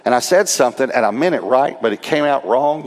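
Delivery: usual speaking voice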